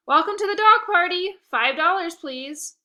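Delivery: put-on young host collecting donations, loud and high; eager host greeting. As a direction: greet and ask for cash brightly. Host greeting, asking money.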